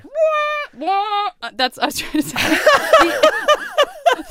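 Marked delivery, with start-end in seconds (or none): 0.1-1.3 high wailing air horn sound; air horn noise. Shown as a demonstration. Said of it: "the air horn I ... trying to do". 2.4-4.1 burst of laughter. Real, not acted. Laughs at own attempt.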